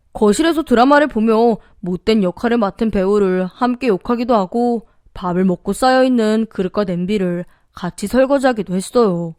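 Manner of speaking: ordinary delivery, nothing marked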